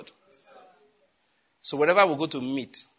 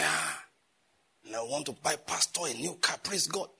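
pious giver; 6 dB quieter than usual. Acted. Acts out member pledging pastor a car.

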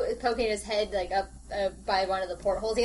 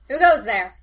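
louder suspicious call from below; 10 dB louder than usual. Acted. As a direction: call up the challenge. NPC calls from below decks.

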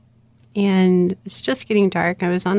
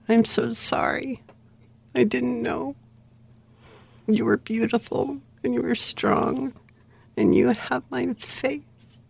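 softer, higher, slowed with held-back emotion; choked-up quote; 4 semitones higher, 4 dB quieter than usual. Retold or imitated. Long-lost father apologizes to his daughter by phone, choked up. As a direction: quote it tenderly, voice close to tears.

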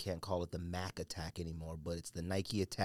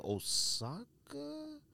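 very high, slow questioning squeak; high, drawn-out unsure question; unsure guess; 5 dB quieter, much slower than usual. Real, not acted. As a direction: float the name up like a wild guess. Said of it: "I can't remember".

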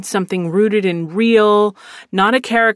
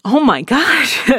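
exasperated disbelief; 6 semitones higher, 3 dB louder, slower than usual. Retold or imitated. Reacting to student refusing roles.

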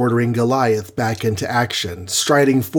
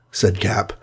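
usual speaking voice

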